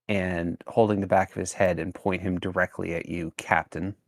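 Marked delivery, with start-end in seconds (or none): none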